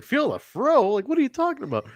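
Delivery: imitating a baffled reply, pitched high; mock bafflement. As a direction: go high on the incredulous question.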